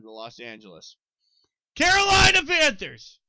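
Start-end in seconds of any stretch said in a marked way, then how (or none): 1.7-3.2 sudden full-throated yell, much higher and louder; real yell. Real, not acted. Podcaster yelling at a rival team he dislikes, loud shout. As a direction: bellow the team name across the room. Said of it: "Sorry I yelled at you".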